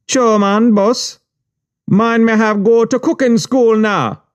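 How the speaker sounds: very high Jamaican voice; eager high Jamaican patois; Jamaican accent. Retold or imitated. Juice maker voiced in story.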